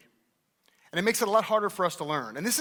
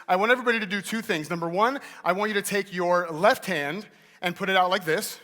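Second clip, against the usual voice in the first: usual speaking voice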